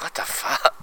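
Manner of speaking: soft, whispered what the fuck; whispered disbelief. Retold or imitated. Viewer stunned by a sad ending, whispering in disbelief. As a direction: breathe it out in hushed disbelief. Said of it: "You're like".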